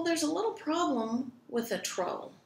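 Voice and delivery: ordinary delivery, nothing marked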